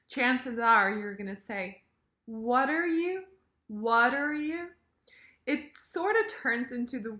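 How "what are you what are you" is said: "What are" linked like water, pitched higher; smushed "What are". Shown as a demonstration. Teacher shows quick casual speech, smushed "What are". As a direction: link "What are you" into one rising run. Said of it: "smush all the words together".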